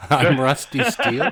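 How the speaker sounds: laughing, high and loud. Real, not acted. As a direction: laugh through the name. Laughs while naming himself.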